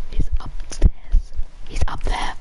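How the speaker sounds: urgent whisper while hiding. Real, not acted. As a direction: hush it, enemy close by. Hiding from an enemy.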